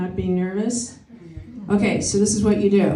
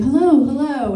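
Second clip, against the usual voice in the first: bright, louder friendly greeting; friendly hello; 5 semitones higher, 5 dB louder than usual. Retold or imitated. A friendly greeter hails strangers on the street, loud and cheerful. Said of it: "I'm saying".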